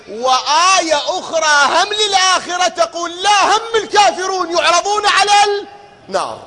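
fiery sermon climax; emphatic preaching shout. Real, not acted. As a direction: cry the point out.

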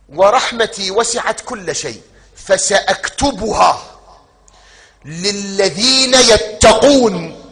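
high, loud, slow declaimed verse; preaching at full force. Acted. Preacher declaims the verse of mercy to the congregation, loud and slow. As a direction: proclaim the verse with full power.

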